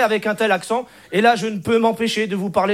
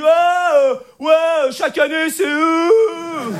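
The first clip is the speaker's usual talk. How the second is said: hammed-up indignant shout; mock angry complaint; 4 semitones higher, much slower than usual. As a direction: shout it like an outraged audience member. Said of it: "il dit".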